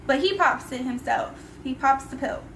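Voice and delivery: ordinary talk, nothing marked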